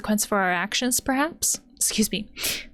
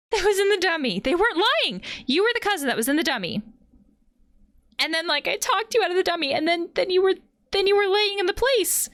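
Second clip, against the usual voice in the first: high, animated rush; excited realization; 9 semitones higher than usual. Real, not acted. Player realizing the ghost was the cousin, excited.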